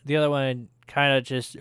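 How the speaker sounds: usual speaking voice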